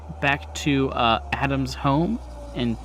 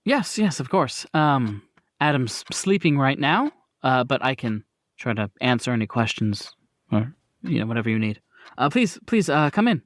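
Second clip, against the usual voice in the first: a hint of a hesitant, lower older woman; nervous hostess, voice dropping lower; lightly voiced Miss Victoza; 5 semitones lower than usual. GM plays Miss Victoza welcoming the visitors, lightly voiced and hesitant.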